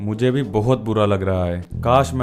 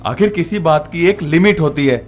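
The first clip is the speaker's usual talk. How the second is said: raised voice, heated objection; indignant protest; 5 semitones lower, 6 dB louder, faster than usual. Acted. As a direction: cut off the scolding, fed up. Defends against the mother-in-law's insults.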